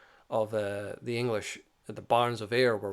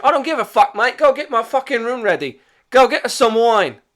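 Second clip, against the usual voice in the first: much higher and louder bullying soldier voice; rude soldier; 12 semitones higher, 14 dB louder than usual. Retold or imitated. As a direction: bark orders like an arrogant occupier. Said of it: "soldier's rude reply".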